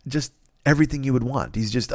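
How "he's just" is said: ordinary delivery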